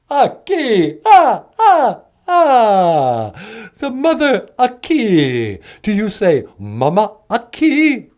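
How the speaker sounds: delighted high vampire cackle and drawl; Count Von Count. Acted. Count repeating the host's word, gleeful vampire voice. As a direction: savour the new word in a swooping vampire accent.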